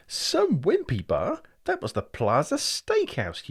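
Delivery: mock the mistake; scornful scoff. Brian correcting his brother, scornful and high.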